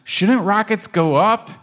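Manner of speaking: high, puzzled kitten; confused kitten. Acted. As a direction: ask it baffled. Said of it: "Pancake is really confused".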